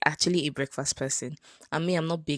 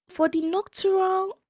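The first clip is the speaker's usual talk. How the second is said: surprised exclamation; 4 dB louder than usual. Real, not acted. High drawn-out exclamation.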